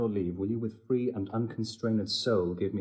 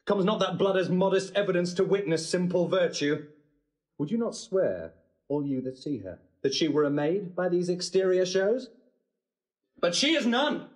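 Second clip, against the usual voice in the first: furious accusation; 8 semitones higher than usual. Acted. Groom accusing his bride before the wedding guests, rising fury. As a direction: denounce her with bitter outrage.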